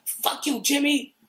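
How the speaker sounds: acted-out angry yell; angry retort. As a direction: snap back angrily, imitating a furious bandmate. Acted retort in retold band quarrel.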